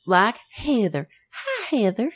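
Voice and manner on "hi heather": put-on Kentucky accent. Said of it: "my best Kentucky accent".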